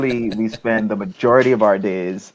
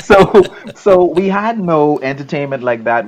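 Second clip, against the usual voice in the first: laughing; 8 semitones higher, 9 dB louder than usual. Real, not acted. Laughing at old antennas.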